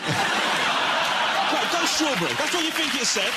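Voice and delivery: high, frustrated parent shouting; exasperated yelling. Retold or imitated. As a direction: rant like a fed-up dad.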